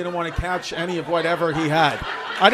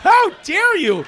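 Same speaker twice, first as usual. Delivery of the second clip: much higher, louder hammed-up shriek; shrieking mock indignation; 12 semitones higher, 11 dB louder, slower than usual. Comic mocking the offended guy, shrieking high and loud. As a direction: shriek it like a prissy drama queen.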